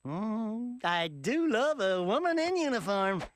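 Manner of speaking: ordinary delivery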